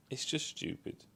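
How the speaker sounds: a tired, whispered repeat; whispered aside. Real, not acted. Reviewer whispering his verdict on the dialogue to himself. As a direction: breathe the words with no voice.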